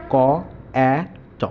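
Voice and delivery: ক্যাট split into ক, এ, ট; sounded out. Shown as a demonstration. Teacher showing the sounds inside the word, sounded out. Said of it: "যেমন ক্যাটের ভিতরে আমরা দেখি".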